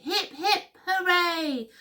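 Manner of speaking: high-pitched happy cheer; hooting. A narrator's reading. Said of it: "They hooted".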